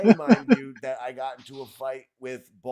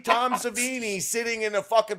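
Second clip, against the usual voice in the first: loud, laughing indignation; laughing protest; 9 semitones higher, 13 dB louder than usual. Real, not acted. Defending himself to the host, laughing loud. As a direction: push back through laughter.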